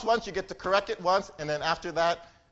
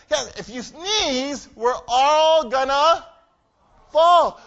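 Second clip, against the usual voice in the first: slowed, drawn-out warning, louder at the end; 4 semitones higher, 5 dB louder, much slower than usual. Acted. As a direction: spell out the danger slowly. Warning the sneezing turtle.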